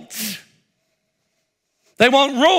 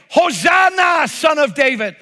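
crowd shouting; 5 semitones higher, slower than usual. Retold or imitated. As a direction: beg the rescuer to act now. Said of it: "ordinary men and women shouting".

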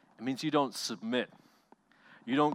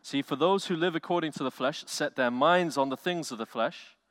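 ordinary talk

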